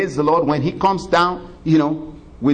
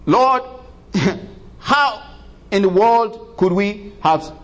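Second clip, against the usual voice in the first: pleading sinners; 6 semitones higher, much slower than usual. Retold or imitated. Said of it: "And they would say".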